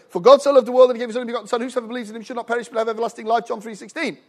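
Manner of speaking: very fast verse reading; brisk, run-on recital; rapid-fire recitation. Retold or imitated. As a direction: rattle the verse off in one breath. Rattles off verse from the card.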